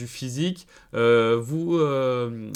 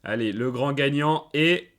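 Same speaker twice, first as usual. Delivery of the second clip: booming prize announcer; 3 semitones higher than usual. Acted. Announcing the grand winner.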